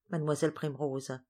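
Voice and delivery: plain reading voice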